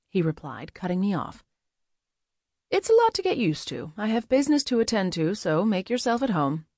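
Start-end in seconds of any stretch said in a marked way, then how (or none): 2.7-6.7 lifted character voice easing down; Adriel's polite dismissal. Acted. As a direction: dismiss a guest politely, starting high.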